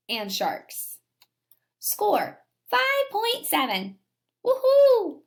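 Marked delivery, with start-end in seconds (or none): 4.4-5.2 high, loud whoop of joy; cheering whoop. Acted. Teacher voicing the squirrel's cheer, loud and high. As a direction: cheer like you just scored.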